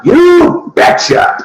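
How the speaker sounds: slow, high drawn-out cheer; hammed-up folksy cheer; folksy you betcha. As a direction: stretch it out like a cheerful Midwesterner. Folksy catchphrase punchline.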